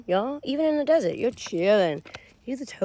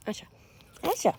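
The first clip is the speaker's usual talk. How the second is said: ordinary talk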